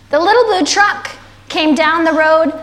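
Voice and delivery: slow, lilting picture-book narration; story reading to children. A narrator's reading. Reading The Little Blue Truck to kids, lilting storytelling. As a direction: draw out each rhyming phrase for little listeners.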